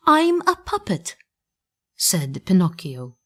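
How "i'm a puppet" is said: much higher puppet voice, insisting; a little puppet squeaking out who he is; puppet protesting. A narrator's reading. Pinocchio telling the green fisherman he is a puppet, high and insistent.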